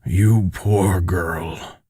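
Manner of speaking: slow, hushed exclamation; exclaimed pity. A narrator's reading. A man exclaims over a poor girl, slow hushed pity. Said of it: "he exclaims".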